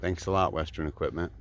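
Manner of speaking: dry sarcastic thanks, low and soft; mock ungrateful. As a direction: grumble thanks as a deadpan joke. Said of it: "No, I'm just kidding".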